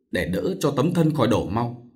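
usual speaking voice

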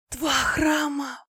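high, very slow, overwhelmed murmur; awed babbling. A narrator's reading. Goddess offered a second temple, awed high babble. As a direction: stretch it out in dazed delight. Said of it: "пролепетала Аня".

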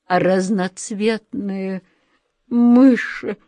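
slow, faint moaning start rising on mice; dying moan. A narrator's reading. A fainted director moaning into the phone, lightly voiced. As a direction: groan it out like a man fading away. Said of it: "умирающим голосом простонал он".

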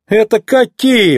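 high, drawn-out incredulous question; exclaiming. A narrator's reading. McQueen hears of a huge legal bill, exclaiming. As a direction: exclaim it in disbelief. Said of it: "воскликнул Маккуин".

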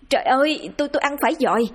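high-pitched, stammering cry of disgust; horrified cry. A narrator's reading. Bà Hà discovers maggots in her rice, horrified cry. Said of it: "bà vội hét lên".

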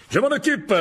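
very high, loud boast; eager showman. A narrator's reading. Lockhart insists he'll handle the snake, high and loud. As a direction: jump in eagerly to show off.

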